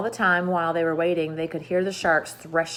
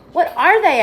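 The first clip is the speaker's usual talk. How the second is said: worried; 10 semitones higher, 9 dB louder than usual. A narrator's reading. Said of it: "getting worried".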